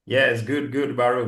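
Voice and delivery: normal talking voice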